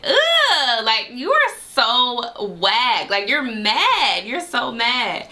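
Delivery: high, slow scornful drawl; drawn-out high disgusted teasing; sassy mock dismissal. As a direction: look down on him, stretch it out high. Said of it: "I was just like".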